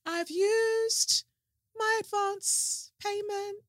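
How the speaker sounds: playful high-pitched joke voice; high jokey voice. Pretend. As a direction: pipe it up like a guilty kid. Joking confession of spent advance.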